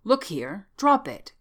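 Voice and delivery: loud, high-pitched command; sharp cry. A narrator's reading. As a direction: cry out to stop him, voice jumping up. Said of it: "cried Smith".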